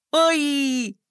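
high, sharp cry of pain; pained yelp. A narrator's reading. Girl hit in the eye by a puck, yelping. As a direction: yelp as if struck.

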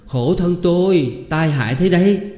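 slow, high, loud wailing cry; howling lament. A narrator's reading. Wife wails at husband's ruinous debts, slow high lament. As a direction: drag out a howl of misery. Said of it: "Tôi tru lên".

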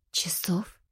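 ошеломлённо, шёпотом, с вопросом; выдохни едва слышно, не веря; изумлённый шёпот. A narrator's reading. Героиня ошеломлена сроком. (изумлённый шёпот)

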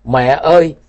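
a choked-up call for mother; choked cry. A narrator's reading. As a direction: cry out through a tight throat. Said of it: "nghẹn ngào kêu lên một tiếng". (tiếng gọi nghẹn ngào)

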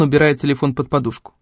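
plain reading voice

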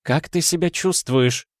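high, doting woman's voice; high-pitched motherly worry; anxious mother. A narrator's reading. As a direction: ask high and full of concern. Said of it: "спросила Герда Эриковна, вновь и вновь целуя дочь".

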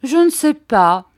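high, loud show of wondering; affected hesitancy, pitched high; feigned indecision. A narrator's reading. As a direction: make a show of not having decided. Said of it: "en prenant un air d'indécision".

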